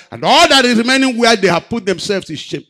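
high, loud preacher's peak; preaching at full force. Acted. Preacher crying out to the congregation about shame, full force. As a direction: lift it to a ringing climax.

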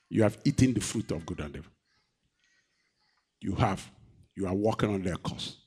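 usual speaking voice

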